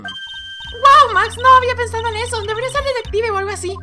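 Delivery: very high squeaky voice; impressed game character. Acted. Praising Max's idea, high-pitched gushing character. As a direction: gush in a high-pitched put-on voice.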